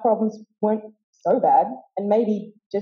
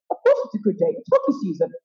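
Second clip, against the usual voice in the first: upbeat coach, first phrase swooping much higher; a light impression of a cheery hockey coach; mock-cheerful, as if nothing could be better; imitating Bear; faster than usual. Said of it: "Bear would say".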